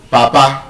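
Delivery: "Papa" with two full a vowels; movie-style pronunciation. Retold or imitated. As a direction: say "Papa" as heard in the movies, no schwa. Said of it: "You hear them say".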